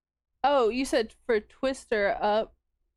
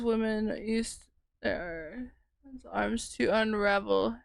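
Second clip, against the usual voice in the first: ordinary talk, nothing marked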